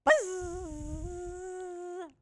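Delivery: high drawn-out fly buzz; crying fly buzz. Acted. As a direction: call for help in a long buzz. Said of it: "The fly cried".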